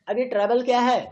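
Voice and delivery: ordinary delivery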